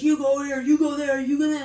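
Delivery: high, loud, clipped commands; barking orders. Retold or imitated. Guard ordering the prisoner around, high loud barking. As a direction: bark each order at him. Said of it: "barking orders at the guy".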